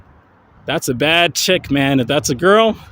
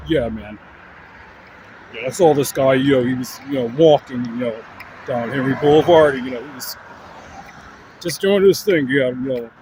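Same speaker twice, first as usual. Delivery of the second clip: a hint of a lower, laid-back gym bro; bodybuilder dude voice; 3 semitones lower than usual. Vlogger voices the dog as a human gym bro, lightly lower. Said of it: "he would sound like this".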